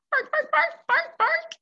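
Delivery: very high, loud yappy barks; excited little puppy; dog barking. Acted.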